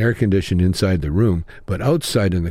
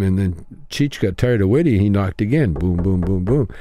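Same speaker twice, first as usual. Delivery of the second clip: ordinary talk, nothing marked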